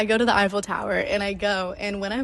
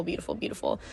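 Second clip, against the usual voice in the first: breathy whisper; 2 semitones lower, 7 dB quieter than usual. Real, not acted. Gushing over her new ring.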